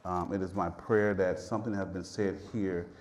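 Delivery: low, soft, even prayer voice; closing prayer. Real, not acted. Preacher thanking the Father at the close of service, low soft prayer. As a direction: drop into a hushed, reverent prayer.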